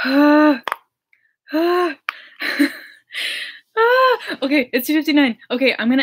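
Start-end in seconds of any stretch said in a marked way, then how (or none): 0.1-2.0 short, high "ah" squeals; excited squeal. Real, not acted. Nervous excitement, two minutes left. 3.8-4.4 very high, loud "ah" squeal; excited squeal. Real, not acted. Nervous excitement before premiere.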